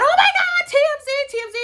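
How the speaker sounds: squealing high, loud excitement; mock freak-out. Past self screaming with excitement over TMZ news. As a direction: shriek it like you just got huge news. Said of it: "I would have freaked out".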